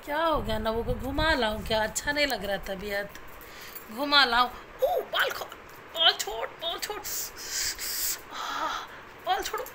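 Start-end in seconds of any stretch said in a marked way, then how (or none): 4.8-9.6 yelp of pain. Real, not acted. Baby pulling her hair.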